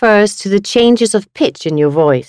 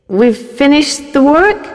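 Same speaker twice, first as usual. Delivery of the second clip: high with a rising end; questioning surprise; 8 semitones higher than usual. Shown as a demonstration. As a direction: ask it in disbelief. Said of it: "according to the speaker's tone of voice".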